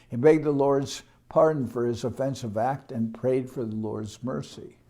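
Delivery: ordinary delivery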